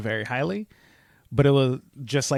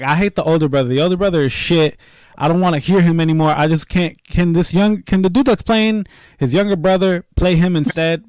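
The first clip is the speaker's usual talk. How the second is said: louder, higher, fed-up complaining; exasperated rant; 5 semitones higher, 7 dB louder than usual. Retold or imitated. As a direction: rant like you can't stand him anymore. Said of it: "the little rant".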